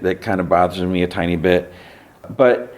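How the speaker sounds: ordinary talk, nothing marked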